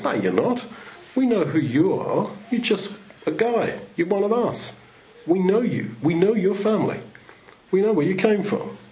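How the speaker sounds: lightly voiced sneering neighbours brushing him off; scornful villagers. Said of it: "they said".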